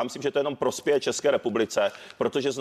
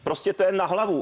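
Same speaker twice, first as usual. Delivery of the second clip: heated raised voice; 7 semitones higher than usual. Real, not acted. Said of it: "čím víc budete křičet".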